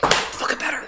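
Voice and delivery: unvoiced muttered curse; cursing under breath. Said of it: "I say under my breath".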